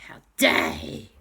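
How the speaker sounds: low, rough indignant growl; mock outrage. A narrator's reading.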